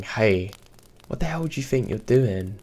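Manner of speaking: understated narrated yell, pitch lifting; mild mock yell. A narrator's reading. Man challenging a fleeing driver, mild mock yell. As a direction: lightly act out an indignant shout. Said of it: "the man who was yelling".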